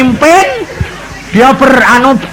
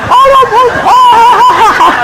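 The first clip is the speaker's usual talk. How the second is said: much higher, laughing call of Allah; crying out to God; 14 semitones higher than usual. Retold or imitated. Danu blurting under the cover, high and laughing. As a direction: cry out Allah helplessly. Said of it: "terus dia bilang".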